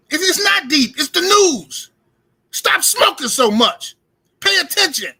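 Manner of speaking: fed-up real rant at his audience; ranting shout. Real, not acted. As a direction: lecture them hard, half yelling. Ranting at viewers who smoke.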